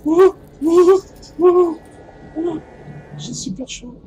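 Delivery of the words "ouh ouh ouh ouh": loud, high panicked ouh cries; panicked high hooting. Real, not acted. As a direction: hoot in shrill panic while running. Panicked chase, high hoots.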